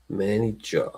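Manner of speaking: MANAGER in a put-on British accent. Shown as a demonstration. Repeating the coach's title, mock British accent. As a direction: softly imitate British "manager".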